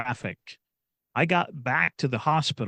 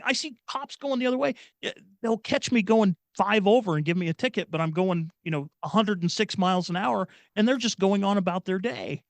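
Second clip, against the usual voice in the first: higher-pitched, incredulous complaint; exasperated recounting; 7 semitones higher than usual. Retold or imitated. Speeding dad recalling cops going the other way, exasperated. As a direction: retell it with mild, high-pitched exasperation.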